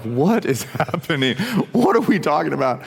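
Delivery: mock bewilderment with a laugh in it; baffled child. Kid in the back of the car baffled by dad's talk, mock bewildered. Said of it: "he's in the back of the car just, like, eyes like".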